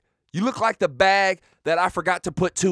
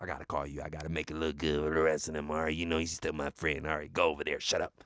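very low, soft undertone; hushed aside; 12 dB quieter, faster than usual. Pretend. Host excusing calling out his friend, hushed. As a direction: explain it confidentially under your breath.

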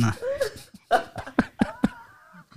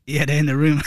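ordinary talk, nothing marked

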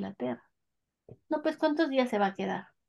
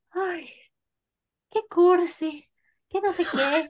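mock-scornful, higher and louder; mocking cheesiness; 7 semitones higher, 4 dB louder, slower than usual. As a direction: tease the proposal as corny. Y yo así haciendo comentarios de. (burlándose de lo cursi, más agudo y fuerte)

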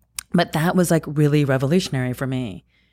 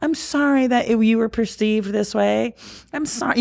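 lightly voiced kind apology, much higher; imagined apology; 9 semitones higher than usual. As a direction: say sorry the way you wish someone had. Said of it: "No one else is gonna be like".

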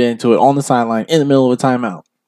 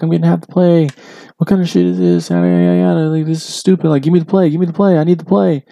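higher mock rant from Malik, demanding the play; imitating angry quarterback; 6 semitones higher than usual. Retelling Malik ranting at the coach on the sideline, higher mock complaint. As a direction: whine it up like a fuming quarterback. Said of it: "he's like".

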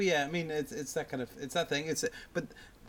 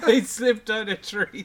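slow words pushed out through real laughter; laughing; 9 semitones higher, 9 dB louder, slower than usual. Real, not acted. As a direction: laugh the line out, higher and louder. Laughing through own joke.

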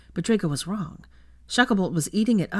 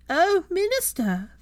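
much higher, slow swooning falsetto; grinning falsetto; 14 semitones higher, slower than usual. Acted. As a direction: coo it with a grin. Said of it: "Theo started with a grin".

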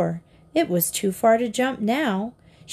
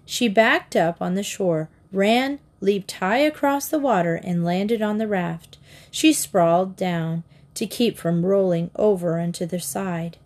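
ordinary delivery, nothing marked

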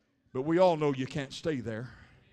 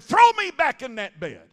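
loud mock scream of protest; imitated outburst, voice raised; retold yell; 7 semitones higher, 12 dB louder than usual. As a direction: holler it as the hurting patient. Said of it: "I yelled and I screamed".

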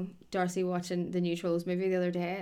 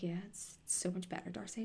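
teasing murmur; 15 dB quieter than usual. Retold or imitated. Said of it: "we were just like".

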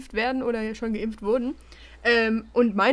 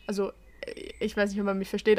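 ordinary talk, nothing marked

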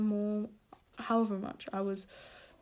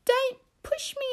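much higher, slow whiny mock cry; mock whiny girl; 5 dB louder than usual. A girl on the soccer pitch whining, hammed-up high whine. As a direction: ham up a whiny kid's complaint. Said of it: "And she's".

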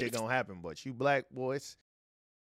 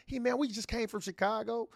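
much higher, pleading voice to the officer; nervous high voice to cop; 11 semitones higher than usual. Retold or imitated. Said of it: "he put an accent".

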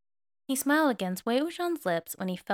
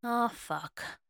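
groaned curse; 3 semitones higher than usual. A narrator's reading. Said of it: "Wei Wuxian groaned".

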